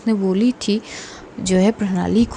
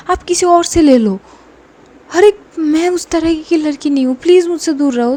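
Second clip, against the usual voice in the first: hammed-up angry outburst, a little higher; acted shout; 4 semitones higher, 3 dB louder than usual. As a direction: snap back at her in a staged yell. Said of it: "एकदम से चिल्ला पड़ती है".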